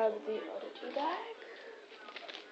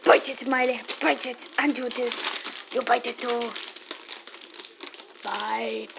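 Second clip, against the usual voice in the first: egging on; 5 dB louder than usual. Real, not acted. Egging the dog on.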